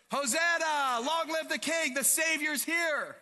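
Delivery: high, loud jubilant cry; crowd cheer. Acted. Preacher leads congregation hailing the Savior, cheering loudly. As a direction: shout it to lead the cheering.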